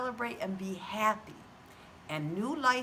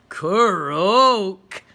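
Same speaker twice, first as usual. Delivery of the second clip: very loud croak from Freddy; Freddy croaking back proudly; 14 dB louder than usual. A narrator's reading. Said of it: "said Freddy".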